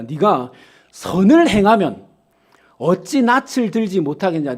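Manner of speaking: loud, slow, weighty scripture line; God questioning Cain. Acted. Preacher voicing God's rebuke to Cain, loud and slow. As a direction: proclaim God's question with force.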